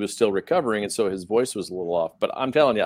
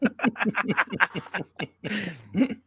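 1 laughing fit; 10 semitones higher, 4 dB quieter than usual. Real, not acted. After teasing about the stumble.

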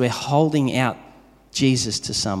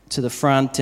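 ordinary talk, nothing marked

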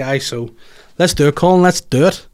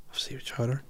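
much softer, dreamy repeat of Seafood chowder; savouring murmur; 13 dB quieter than usual. Real, not acted. Dreaming of his last meal, saying it softly to himself. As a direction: say it to yourself, savouring it.

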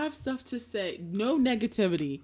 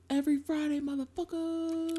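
hype announcer; 9 semitones higher than usual. Acted. Hyped sign-off announcement.

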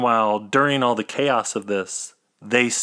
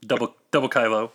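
usual speaking voice

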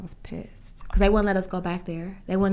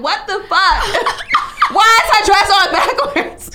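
high, loud, laughing disbelief; shocked laughing outburst; 10 semitones higher, 8 dB louder, slower than usual. Retold or imitated. Seeing the model come out with the dress on backwards, shocked laughing outburst. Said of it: "It's like".

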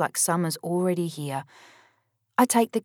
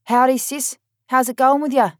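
loud, higher and brash; 6 semitones higher, 7 dB louder than usual. A narrator's reading. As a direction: boom it down the phone. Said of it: "she talks loud back".

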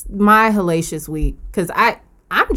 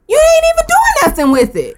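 much higher, mocking squeak; 4 dB louder than usual. Mocking whoever sits on the money, in a high squeak. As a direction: squeak it like a nagging voice.

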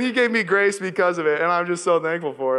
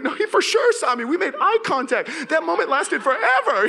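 high, loud and laughing through it; panicky, laughing protest; 11 semitones higher, 4 dB louder than usual. Retold or imitated. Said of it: "So I start freaking out".

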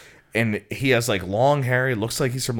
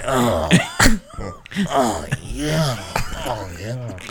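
low odd voice, laughing between; lightly done weird voice impression; Frogman voice; 3 semitones lower, 5 dB quieter, much slower than usual. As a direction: riff on the Frogman's voice. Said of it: "Dude, that's the Frogman".

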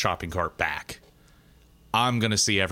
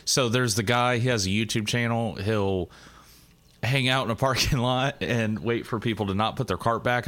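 normal talking voice

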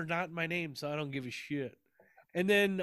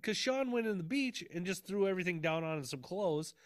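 ordinary talk, nothing marked

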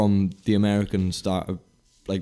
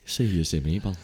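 put-on Scottish accent. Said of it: "best Scottish accent".

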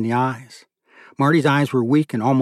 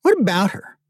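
high-pitched, defensive comeback; defensive retort; 8 semitones higher than usual. Acted. Younger brother answering a question about Vivian, defensive.

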